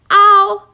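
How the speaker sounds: high, loud shriek of pain; sharp shriek as a nettle lands on her nose. A narrator's reading. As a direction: yelp as the nettle stings. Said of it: "she shrieked".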